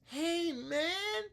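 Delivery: very high small-student hey man; squeaky little student echoing. Shown as a demonstration. Student copies teacher, very high. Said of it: "if that wasn't clear".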